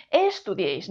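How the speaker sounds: "estudiéis" mis-stressed as ES-tudieis; "estudiéis" with the accent thrown onto ES; wrong first-syllable stress. Shown as a demonstration. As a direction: punch the "es" of "estudiéis" instead of the ending. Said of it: "El golpe de voz está aquí. No decimos".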